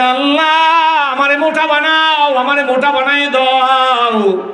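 high, drawn-out imploring cry; calling out to Allah. Retold or imitated. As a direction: cry out the plea in a long sermon wail. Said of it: "দৌড়ে ডাক দেয়". (উঁচু সুরে টেনে আল্লাহকে ডাকা)

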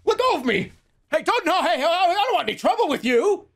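high, hammed-up frightened pleading; mock panicked NPC. Imitating a grabbed GTA NPC, hammed-up panic up high. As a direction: play a scared bystander begging. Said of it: "they go".

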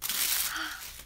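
sharp soft gasp of surprise; little gasp of discovery; surprised gasp. Real, not acted. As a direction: gasp as you spot it. Finds gift in package.

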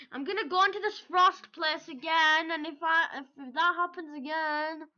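loud, high heated threat; angry gamer. Real, not acted. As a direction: threaten the boss with rising anger. Threatening the game boss.

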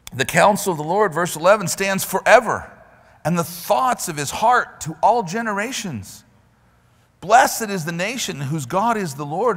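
loud, high preacher's cry, racing ahead; preaching. Acted. Preacher urging the congregation to stand, loud and fast. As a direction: drive the scripture home hard. Reading psalm at full force.

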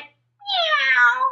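long, high drawn-out meow; frog's meow. A narrator's reading. Storyteller voicing the frog's last meow, drawn-out meow. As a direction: stretch out a high imitated meow. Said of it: "Chester T Frog went".

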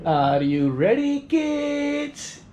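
loud, drawn-out rallying call; hammed-up show-opening shout; cartoon captain call. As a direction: call out slowly like a cartoon captain, rising high. Performs cartoon call-and-response opening chant.

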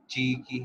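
ची की said slowly for students; pinyin j sound. Shown as a demonstration. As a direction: sound out ची की as the j initial. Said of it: "जे sound देता है". (जे की ध्वनि ची की बोलकर दिखाना)

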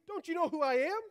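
puffed-up outrage at being ignored; indignant. A narrator's reading. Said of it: "he said crossly".

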